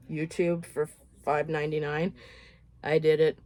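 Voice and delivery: ordinary talk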